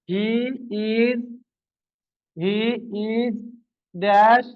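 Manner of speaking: each word spaced out for writing down; slow dictation. Shown as a demonstration. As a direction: dictate word by word with long gaps. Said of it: "কি বললাম?". (ধীরে ধীরে শ্রুতিলিখন)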